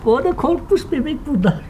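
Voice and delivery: high, soft cooing to a frightened pup. Real, not acted. Cooing to a scared puppy.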